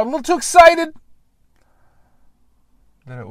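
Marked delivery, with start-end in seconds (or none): 0.0-0.9 sudden very high, loud excited burst; too excited. Shown as a demonstration. Explaining his park recording spot, bursting out in a high excited yelp. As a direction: show them what too excited sounds like. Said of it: "if I got a little too loud".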